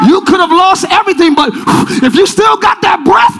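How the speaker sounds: high, shouted pulpit exhortation; rally the congregation at the top of your voice; driving preacher's shout with long-held cries; full-force preaching. Real, not acted.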